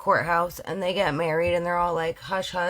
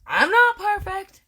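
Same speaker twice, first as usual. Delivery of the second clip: high squeak; 12 semitones higher, 5 dB louder than usual. Real, not acted. Self-mocking after stumble.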